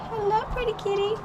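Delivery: high sing-song pet voice; pet talk. Real, not acted. Owner greeting her pretty kitty in a high coo.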